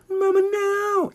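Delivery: put-on high, loud whining child; whiny little boy. A grown man voicing a whining boy at the gate. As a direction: wail like a small kid refusing.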